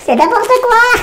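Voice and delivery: squeaky helium-pitched voice. Real, not acted. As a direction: talk after a lungful of helium. After breathing helium from balloon. (voix à l'hélium)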